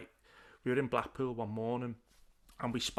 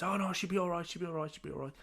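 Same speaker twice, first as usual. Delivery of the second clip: higher-pitched, repetitive imitation of reassurance; breezy reassurance; 5 semitones higher, faster than usual. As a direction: wave the worry away as the friend did. Retells friend brushing off worries.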